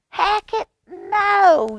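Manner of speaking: high, loud cry of "Hackett, no"; yelled. Acted. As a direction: stop him mid-thump like a bossy sister. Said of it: "yelled Ivy".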